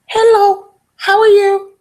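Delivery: high squawky parrot mimicry; high parrot-like greeting. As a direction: chirp it like a pet parrot. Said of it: "you can teach a parrot to say".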